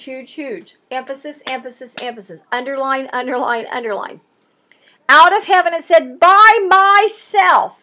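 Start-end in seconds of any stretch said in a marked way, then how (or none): 6.2-7.8 "By myself" slow, higher and louder; slow booming scripture quote; emphatic proclamation. Acted. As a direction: ring out "By myself" like a voice from heaven. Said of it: "He's, he's emphasizing".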